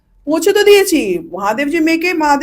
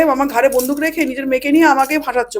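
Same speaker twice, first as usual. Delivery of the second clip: normal talking voice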